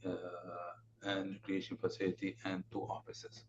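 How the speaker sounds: very soft, slow trailing voice; barely audible, slowly dropping away; too quiet to hear. Real, not acted. Presenter finishing a list of facilities, too quietly to hear. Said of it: "can you speak up a bit".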